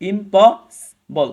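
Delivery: loud slow syllable demo. Shown as a demonstration. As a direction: hammer out each part of ইমপসিবল. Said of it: "চারটা ভাগে ভাগ করা যায়".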